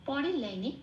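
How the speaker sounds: normal talking voice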